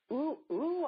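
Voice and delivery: an eager, delighted realisation; delighted ooh. Retold or imitated. Friend imitating her own thrilled reaction to a great question. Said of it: "your questions have me go".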